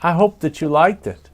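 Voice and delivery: usual speaking voice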